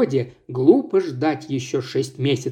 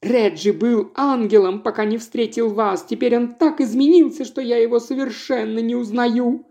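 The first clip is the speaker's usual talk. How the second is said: wailing; 6 semitones higher than usual. Acted. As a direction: lament her lost husband. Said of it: "продолжала вопить Ирис".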